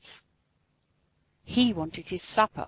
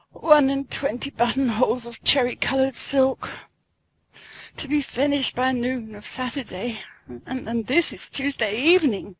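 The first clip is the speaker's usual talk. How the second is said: lamenting; 4 semitones higher than usual. Acted.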